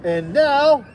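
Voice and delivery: high, loud excited cry; high, loud call to begin; cried out. Acted. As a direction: announce it like a little king. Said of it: "cried Max".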